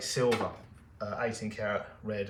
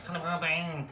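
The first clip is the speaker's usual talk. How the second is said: Tully Bardeen in a higher, put-on Scottish accent; 6 semitones higher than usual. Shown as a demonstration. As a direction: say Tully Bardeen the way a Scot would. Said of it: "That was my Scottish accent".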